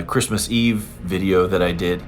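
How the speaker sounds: ordinary talk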